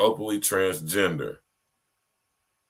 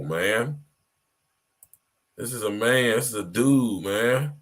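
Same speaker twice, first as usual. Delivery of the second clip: ordinary talk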